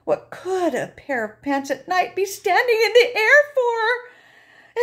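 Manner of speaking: high, loud, scared wail; frightened cry. Acted. Narrator frightened by floating pants, crying out.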